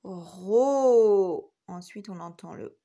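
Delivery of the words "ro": Ro loud and stretched; syllable sounded out. Shown as a demonstration. Teacher breaking robot down for pupils, first syllable loud and drawn out. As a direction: hold Ro long after a rolling French r.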